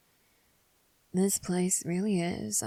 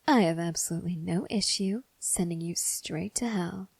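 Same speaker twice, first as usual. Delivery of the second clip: cold whispered threat; menacing whisper. Acted.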